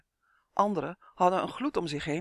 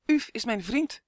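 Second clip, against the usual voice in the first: higher, hurriedly called out; quick call; 6 semitones higher, 3 dB louder than usual. A narrator's reading. Justin freed to speak to the fairies, quick call. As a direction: blurt it out before being stopped. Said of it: "riep hij vlug".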